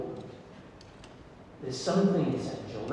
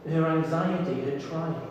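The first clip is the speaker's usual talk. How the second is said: normal talking voice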